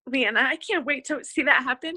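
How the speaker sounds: fighting back tears of pride; real tears breaking through the words; choked up. Real, not acted. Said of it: "I didn't know I was gonna get emotional".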